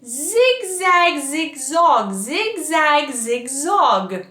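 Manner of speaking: buzzing zigzag, z fully voiced; voiced drill. Shown as a demonstration. Coach demonstrating a voiced zigzag drill. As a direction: let the z in zigzag buzz. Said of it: "voice a little bit more".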